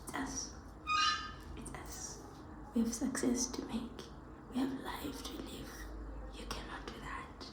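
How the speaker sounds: unvoiced, very soft pleading; a hushed, teary plea; tearful whisper. Real, not acted. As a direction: whisper it to yourself through tears. Whispered self-talk while crying.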